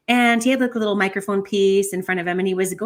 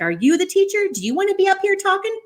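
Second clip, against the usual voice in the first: much higher, loud, fast challenging rant; mock angry tirade; 12 semitones higher, 4 dB louder, faster than usual. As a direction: tear into her like a furious teacher. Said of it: "he lays into her".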